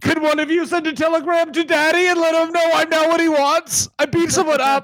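very high, pleading wrestler voice; mock wail of a grown man desperate for his daddy's approval; hammed-up high whine to Daddy; crying daddy's boy. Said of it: "wrestlers were just crying all the time".

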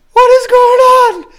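high, loud, drawn-out disbelief; bewildered mock yell. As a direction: cry it out slowly in comic bafflement. Retelling bewildered reaction to yeller.

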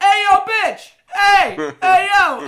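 very loud, high-pitched shout; guttural yell. Acted. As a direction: holler rudely across the room at a server. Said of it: "where you can yell a guttural sound at your server".

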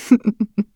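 short wicked laugh; evil cackle. A narrator's reading. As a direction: cackle like a witch. Witch laughs after poisoning.

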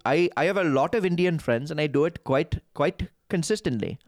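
confident, consistent Indian accent. Shown as a demonstration. Guest demonstrating to podcast hosts his Indian accent. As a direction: speak in a practised Indian accent. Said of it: "I do a very good Indian accent".

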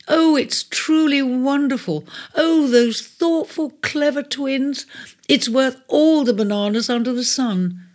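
high, gushing delight over the gift. Acted. Cancer thrilled by the twins' gift of the pearl. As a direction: gush with joy, drawing out the praise.

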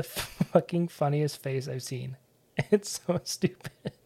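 normal talking voice

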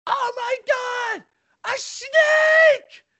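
very high shrieking "a snake!", imitating her terror; terrified scream. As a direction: shriek it like a girlfriend who fears snakes. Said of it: "scream at the top of her lungs".

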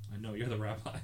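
ordinary talk

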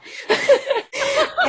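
high, loud burst of laughter. Real, not acted. Laughing over her own bad roots, high loud laugh.

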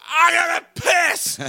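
high, stretched-out mock yell; imitated shouted protest. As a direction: yell back in exaggerated desperation. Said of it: "He's like".

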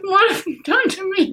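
high laughing protest. Real, not acted. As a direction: laugh out the question. Laughing fit.

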